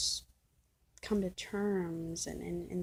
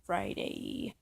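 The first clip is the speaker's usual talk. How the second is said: Friday dropped much lower, playful; mock deep Friday; 10 semitones lower than usual. Repeating the date to the camera, mock deep. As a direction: growl Friday low for fun.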